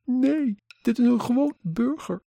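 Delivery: put-on very high young woman's voice; emotional young woman. A narrator's reading. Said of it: "de jonge vrouw". (hoge stem van een geëmotioneerde jonge vrouw)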